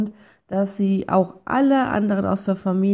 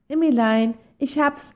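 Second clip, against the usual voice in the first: higher, bright excited call to Emmy; happy call; 5 semitones higher than usual. Acted. Pig waking Emmy with a happy, higher call. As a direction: burst out with a happy idea. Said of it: "rief es glücklich".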